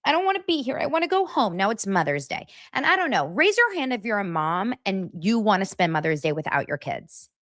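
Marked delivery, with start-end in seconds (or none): 0.0-1.6 high whiny mocking voice; whiny mock pout. Mocking a reality star, high whiny pout. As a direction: whine like a spoiled housewife.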